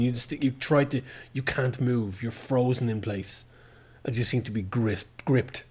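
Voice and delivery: ordinary delivery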